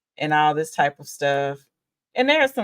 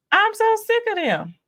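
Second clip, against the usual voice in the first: exasperated. Real, not acted. Exasperated reviewer venting.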